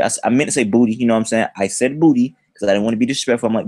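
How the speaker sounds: normal talking voice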